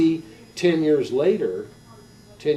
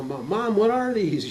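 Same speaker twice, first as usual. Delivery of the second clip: lightly voiced child, pitched up; higher, boyish question; curious little kid; 8 semitones higher, slower than usual. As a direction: ask mom with kid-like wonder. Child asking mom in story.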